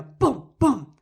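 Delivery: two loud, punchy booms; imitated impact booms. Telling friends about a kid wiping out, loud crash sounds. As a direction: boom it out like a crash.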